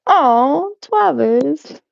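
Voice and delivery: ordinary delivery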